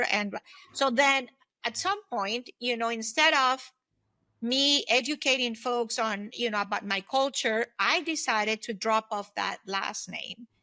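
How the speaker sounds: ordinary talk, nothing marked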